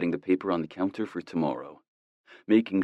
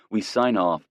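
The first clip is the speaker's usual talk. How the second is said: plain reading voice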